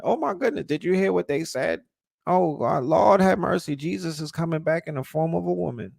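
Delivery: high, mocking starstruck gasp; mock gushing awe. Mocking followers awed by recycled info, high mock gushing. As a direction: gush like a dazzled fan, hammed up. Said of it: "it's all of a sudden".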